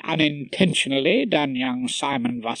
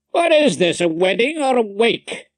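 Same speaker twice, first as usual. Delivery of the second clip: high, raised, rhetorical question; asking at large; 8 semitones higher, 4 dB louder than usual. A narrator's reading. Rumpole asking the world at large, declaiming. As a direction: throw the question out to the whole room. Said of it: "I asked the world at large".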